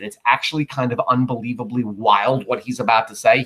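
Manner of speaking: normal talking voice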